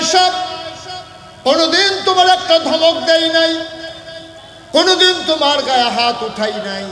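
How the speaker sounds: loud, high impassioned cry; calling out. Retold or imitated. Husband calls to his crying wife, loud impassioned protest. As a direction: call it out at full force, high-pitched. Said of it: "ডাক দিয়া কয়".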